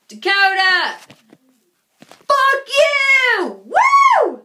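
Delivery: high, loud yelled shout-out; real shouted taunt then love. Real, not acted. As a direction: yell it across to him. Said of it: "this is a shout out to him".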